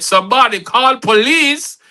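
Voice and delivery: high, loud call for help; neighbor's shout. Acted. Nosy neighbor from next door raising the alarm, shouted. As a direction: shout it out over the fence. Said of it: "The nosy neighbor from next door shouted".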